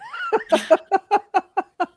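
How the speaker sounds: high, delighted laugh; high burst of laughter. Real, not acted. Laughing at the own Irish accent bit.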